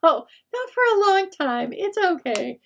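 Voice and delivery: high, loud, lightly voiced grandma backpedalling; grandma reassuring. Grandma soothing her sensitive grandchild, high and loud. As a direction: pitch it way up and soothe the child fast. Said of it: "she goes".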